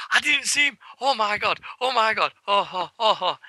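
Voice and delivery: high-pitched flustered imitation; mock startled panic. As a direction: act out someone shaken by a jump scare. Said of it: "jumped out of his fucking skin".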